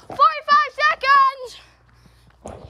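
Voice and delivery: called out slowly, high and loud; loud, drawn-out call. Real, not acted. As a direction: shout it slowly to the woods. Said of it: "I am counting for".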